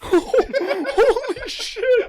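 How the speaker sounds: loud, high, drawn-out shriek of disbelief; shocked outburst. Real, not acted. Friend hearing the blunt minority question, shocked laughing shriek. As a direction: burst out, scandalized and laughing.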